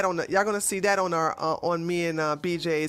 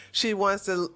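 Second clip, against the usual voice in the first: usual speaking voice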